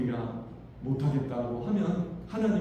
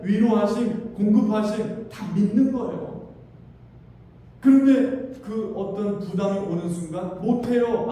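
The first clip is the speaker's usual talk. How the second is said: raised, loud preacher's voice; fiery preaching; 5 semitones higher, 5 dB louder than usual. Acted. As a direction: preach it at full force to the congregation. Preacher at full force. (힘찬 설교)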